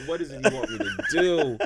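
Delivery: mock exasperated appeal to heaven; questioning God. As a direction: act out pleading upward in frustration. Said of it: "questioning God".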